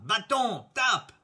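loud, high order; ringing magic command; called-out command. A narrator's reading. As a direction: fling the command out in two punches. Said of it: "Jean lança".

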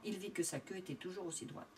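usual speaking voice

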